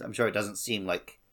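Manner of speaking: normal talking voice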